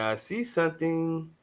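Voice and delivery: softly stretched-out mock quote; imitating someone's quote. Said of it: "That's what he said, man".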